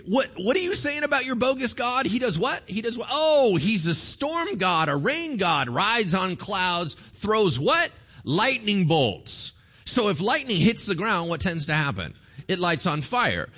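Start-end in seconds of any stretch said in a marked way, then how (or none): 0.1-9.5 mocking Baal's prophets. Mocking Baal's prophets, playing both sides.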